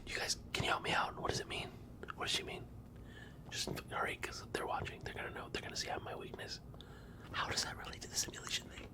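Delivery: urgent paranoid whisper. Acted. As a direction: whisper fast, scared of being watched. Aside pleading to the camera.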